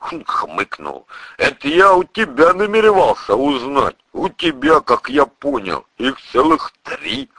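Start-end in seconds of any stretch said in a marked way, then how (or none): 1.4-7.3 a touch of teasing challenge, pitched up; lightly voiced burly priest. Priest answers the narrator's question about women, lightly pointed. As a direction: lightly act the priest turning the question back.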